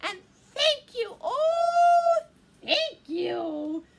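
high, loud gushing thanks; grateful cry. A narrator's reading. Witch thanks her rescuers, crying out gratefully. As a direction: cry out overflowing with gratitude. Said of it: "the grateful witch cried".

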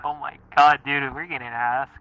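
usual speaking voice

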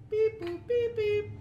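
high mock dial-tone beeps; phone dial beeps. Teen home alone mimicking the phone keypad, high beeps. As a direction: chirp the keypad tones up high.

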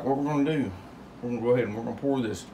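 usual speaking voice

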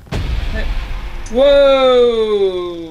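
loud startled whoa; jump-scare yelp; startled shout. Real, not acted. Startled by sudden game event.

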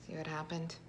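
ordinary delivery

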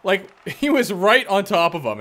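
normal talking voice

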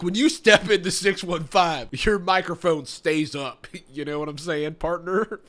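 a hint of a cowboy twang; mild country accent put-on. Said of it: "stereotypical ass accent".

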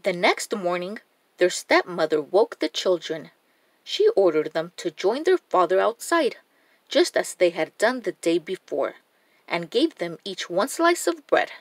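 usual speaking voice

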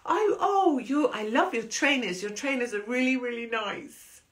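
high, gushing fake-nice voice; mock sweet compliment. To a lady in the pub, high gushing politeness. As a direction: lay the niceness on thick. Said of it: "I was being really nice".

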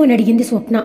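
usual speaking voice

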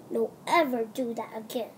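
ordinary talk, nothing marked

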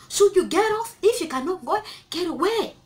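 high, loud plea to leave; urgent command. Real, not acted. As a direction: push her to get away now. Urging girls to leave.

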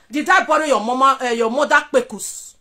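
usual speaking voice

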